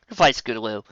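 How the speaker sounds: usual speaking voice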